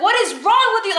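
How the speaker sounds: high outraged demand; retold scream. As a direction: re-enact yelling at your boss. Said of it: "Like, I was screaming".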